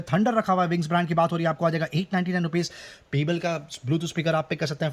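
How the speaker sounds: usual speaking voice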